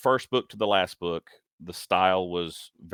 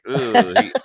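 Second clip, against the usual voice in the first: high laughing groan of disgust; an amused, revolted ugh; 11 semitones higher, 8 dB louder than usual. Retold or imitated. Reacting to bad comic art.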